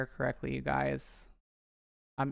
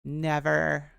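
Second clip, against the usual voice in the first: louder repeat; 5 dB louder than usual. Real, not acted. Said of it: "repeated vow louder".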